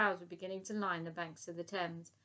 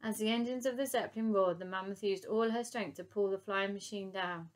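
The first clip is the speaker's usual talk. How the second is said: ordinary delivery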